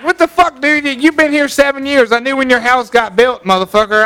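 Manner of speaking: retold angry outburst. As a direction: let the neighbor have it, outraged. Retells confronting his neighbor.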